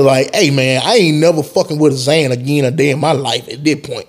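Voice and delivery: normal talking voice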